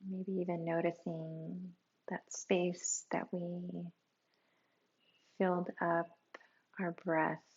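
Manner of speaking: very soft, hushed meditation voice with long pauses; guided meditation. Real, not acted. Teacher guiding listeners through a body scan, hushed and calm. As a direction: ease them inward, sinking toward a near whisper.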